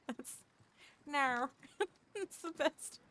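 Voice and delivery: high, laughing self-mockery; laughing at herself. Real, not acted. Streamer giggling over her bad accent attempt. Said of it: "I could do an Aussie accent".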